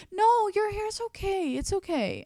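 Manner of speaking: high, slow placating singsong; put-on squeaky soothing, stretching the words; mock reassurance. Stylist soothing worried client about hair, high mock reassurance.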